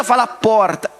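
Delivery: ordinary talk, nothing marked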